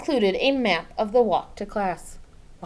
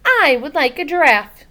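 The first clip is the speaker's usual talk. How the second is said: put-on child's plea; 7 semitones higher, 11 dB louder than usual. Child voiced pleading her case.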